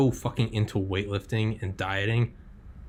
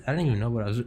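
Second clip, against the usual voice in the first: ordinary talk, nothing marked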